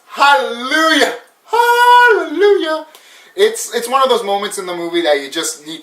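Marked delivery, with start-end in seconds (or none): 0.1-2.9 drawn-out hallelujah, rising much higher and louder; hammed-up churchy hallelujah, climbing to a high cry; mock hallelujah. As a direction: cry it out like an ecstatic gospel choir, slowly. Mock gospel praise of the scene.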